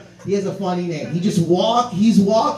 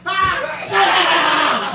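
high, hammed-up repeated scream; wild scream; 8 semitones higher than usual. Sal screams after falling with the guy, mock wild scream. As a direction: imitate a crazed fighter screaming. Sal's cry as he went down.

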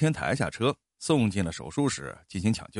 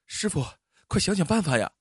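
a higher, urgent call to the driver; urgent plea; 7 semitones higher than usual. Acted. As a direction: hurry him, pleading. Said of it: "冲着司机后面急迫地说". (向司机急切地恳求，声音较高)

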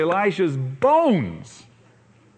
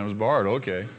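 lower, unbothered Elisha; calm prophet; 7 semitones lower, much faster than usual. Acted. Elisha answers the worker, lower and calm. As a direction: settle it lower, calmly understanding. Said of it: "Oh, I understand".

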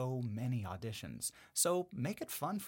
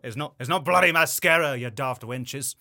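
Jack Sparrow; 3 semitones higher, 13 dB louder than usual. Pretend.

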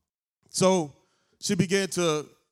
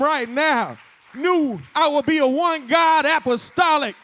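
high, loud building sermon climax; preaching at full force; 9 semitones higher, 5 dB louder than usual. Real, not acted. Preacher proclaiming to his congregation, building to a shout.

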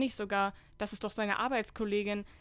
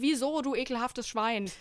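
mock-furious outburst, raised voice; acted yell; 3 semitones higher, 4 dB louder than usual. A narrator's reading. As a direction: cry it out in a melodramatic rage. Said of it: "trample und schreie". (wütend gespielter Aufschrei)

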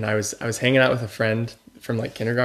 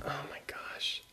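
breathless whisper; hushed whisper at the window; whispered awe; 19 dB quieter than usual. Retold or imitated. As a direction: whisper it in shock.

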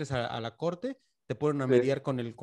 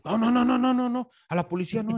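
high, loud rush of frantic noes; panicked protest; 10 semitones higher, 5 dB louder than usual. Retold or imitated. Neighbour panicking when police are mentioned, high frantic protest. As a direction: blurt it out in alarm, pitched way up.

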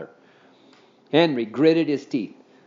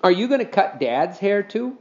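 put-on boy voice; 7 semitones higher than usual. A narrator's reading. Henry's voiced question.

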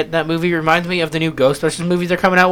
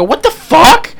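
high, loud shocked what the fuck; shocked outburst; 12 semitones higher, 9 dB louder, much slower than usual. Retold or imitated. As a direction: blurt it out in disbelief. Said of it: "retold shock rereading book".

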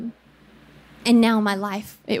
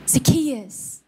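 a mother's gentle naming; loving call. Retold or imitated. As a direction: say his name tenderly. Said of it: "said with love and compassion".

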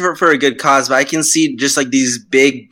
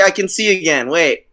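normal talking voice

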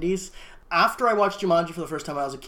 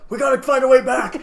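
higher, louder mock-urgent cry; urgent movie hero; 6 semitones higher, 5 dB louder than usual. Joking as Marty and Doc to a co-host, urgent cry. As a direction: throw it out like a panicked time traveler. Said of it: "Marty and Doc are like".

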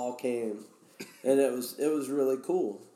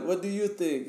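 lightly voiced Russian, pitched up; higher voice for a Russian buddy's question; imitated Russian guy; 7 semitones higher than usual. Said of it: "one of the Russian guys. He's like".

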